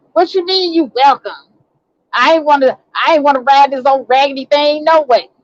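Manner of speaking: mock an ungrateful passenger's offended comeback; indignant retort. Retold mother-in-law's scornful comeback.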